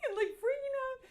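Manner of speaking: high, loud excited carry-over; still squealing. Retold or imitated. Describing her sister freaking out, still high and excited. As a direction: keep the sister's thrill going.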